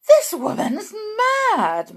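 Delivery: high, loud, drawn-out outrage; indignant outburst. A narrator's reading. Mrs Jenkins to her husband about grandmother, indignant. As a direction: exclaim it as an outraged wife.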